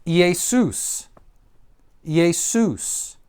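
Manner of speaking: "Jesus" said the Greek way, yay-SOOS; "Jesus" as Greek Iēsous, yay-SOOS; Greek pronunciation. Shown as a demonstration. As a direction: demonstrate "Jesus" as yay-SOOS, falling at the end. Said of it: "Here's a proper noun that you'll recognize".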